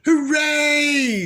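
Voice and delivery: put-on high, loud kids' cheer; cheering shout. A narrator's reading. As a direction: cheer like a happy crowd of kids. Children cheering.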